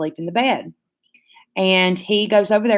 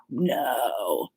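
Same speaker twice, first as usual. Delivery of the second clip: much lower, softer burped no, not human; 6 semitones lower, 9 dB quieter than usual. Retold or imitated. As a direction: say no as if burping it out, like a demon. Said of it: "is if you were to burp and say no".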